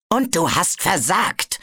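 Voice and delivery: ordinary delivery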